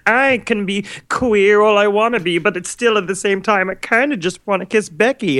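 high-pitched imitation of a theater kid; hammed-up high teenage proclamation. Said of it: "it was a theater kid thing. So everybody was like".

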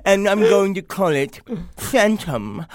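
Ackerman's joke. Retold or imitated. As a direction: tell it like a sly old man. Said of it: "that Oprah joke that he told".

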